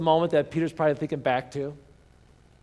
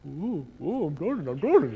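mocking, wobbly mimicry of a friend; hammed-up teasing impression with swooping pitch; goofing around. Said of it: "see them goofing around".

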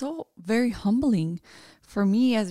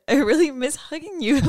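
close to tears; 5 semitones higher, 4 dB louder than usual. Real, not acted. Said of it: "I wanna cry because".

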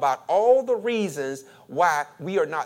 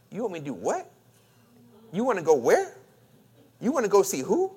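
each last word, "what", "where", "who", swooping up; incredulous parent; 3 semitones higher, faster than usual. Acted. Parent answering a child's request, incredulous. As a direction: question the child with a rise on the back word. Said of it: "put that inflection on the back".

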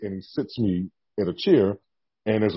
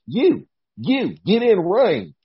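higher, louder mock command; retold barked order; 8 semitones higher, 4 dB louder, much slower than usual. Jamie Noble pointing out a rookie, loud higher-pitched order. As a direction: imitate an official sharply ordering someone in. Agent ordering wrestler into ring.

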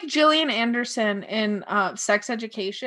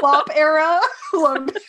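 laughing mock announcement; 4 semitones higher, 6 dB louder than usual. Teasing reply to new era.

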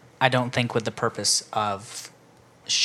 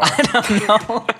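laughing; 8 semitones higher, 5 dB louder, much slower than usual. Real, not acted. Laughing after a silly tangent.

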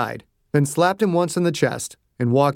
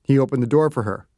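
ordinary delivery

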